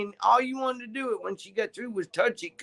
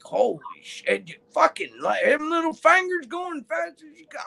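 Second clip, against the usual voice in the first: amazed; 10 semitones higher than usual. Retold or imitated. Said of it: "and I'm like".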